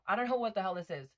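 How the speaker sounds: ordinary talk, nothing marked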